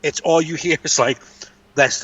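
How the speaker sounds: usual speaking voice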